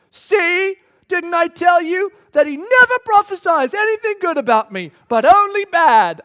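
high, loud, indignant shout; king shouting his complaint; whiny angry king. Acted. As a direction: shout it like a sulking king who was proved right. Ahab complains about prophet.

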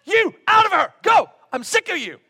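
high, loud fed-up command; exasperated loud order. Retold or imitated. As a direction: snap like a man out of patience. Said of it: "he went".

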